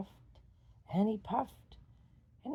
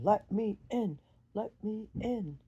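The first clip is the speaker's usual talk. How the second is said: louder insistent call; demanding wolf; 3 semitones higher, 6 dB louder than usual. Acted. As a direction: shout to be let in.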